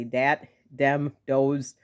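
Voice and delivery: DAT, DEM, DOES with hard d in place of th; south side dat, dem, does with plain d sounds. Shown as a demonstration. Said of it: "people say dat".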